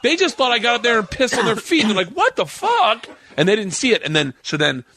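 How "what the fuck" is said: high-pitched mock outrage; retold shock. Imitating the council's reaction to being pissed on, shocked. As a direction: act out a baffled, appalled reaction.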